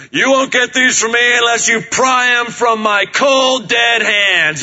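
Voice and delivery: big hammed-up Heston shout; Charlton Heston voice. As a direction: thunder it out like a macho epic star. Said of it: "with this booming Charlton Heston voice".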